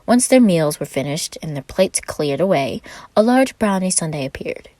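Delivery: ordinary delivery, nothing marked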